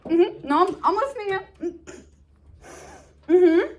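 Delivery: high-pitched pretend listening, stifling a laugh; mock attentive mm-hmms, pitched high; Benvolio. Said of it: "the whole time Benvolio's like".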